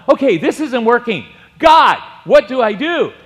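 high loud panicked cry to God; desperate plea. Retold or imitated. Frightened man in the storm cries to God, loud and panicked.

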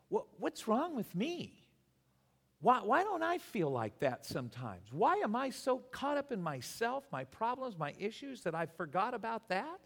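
high-pitched, exasperated self-questioning; self-reproach. Retold or imitated. Preacher questioning his own faith beside the dying believer's, exasperated self-reproach. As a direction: take yourself to task in rising disbelief.

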